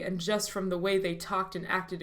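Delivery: usual speaking voice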